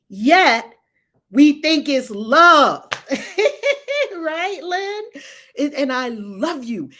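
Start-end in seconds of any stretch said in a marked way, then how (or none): 2.9-4.1 high giggle; laugh. Real, not acted. Laughs after remark on love.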